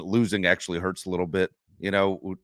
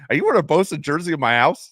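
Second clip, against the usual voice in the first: loud, higher, disbelieving challenge; incredulous; 6 semitones higher, 6 dB louder than usual. Retold or imitated. Talking about a guest's Bosa jersey, loud incredulous challenge. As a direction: call him out in disbelief. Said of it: "And I was like".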